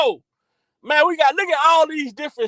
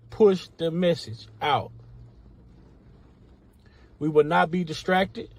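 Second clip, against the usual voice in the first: much lower, softer, measured announcer read; formal disclaimer voice; 9 semitones lower, 7 dB quieter than usual. Acted. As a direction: read the rules like an official announcement. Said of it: "I got a disclaimer for my moderators".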